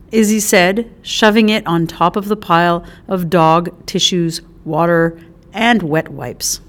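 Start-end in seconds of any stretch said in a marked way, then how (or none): none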